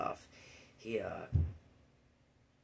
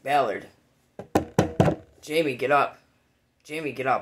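officer waking me; 10 dB louder than usual. Retold or imitated. Officer waking him in mud.

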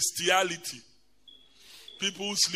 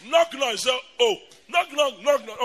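loud, higher excited knock knock; excited retelling; 6 semitones higher, 8 dB louder than usual. Retold or imitated. Telling the congregation about the bet, loud and excited. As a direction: shout the game out eagerly.

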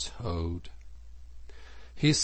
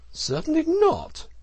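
very high, loud, defiant refusal; emphatic; 6 dB louder than usual. A narrator's reading. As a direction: squeal a flat-out no. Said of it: "replied the Toad emphatically".

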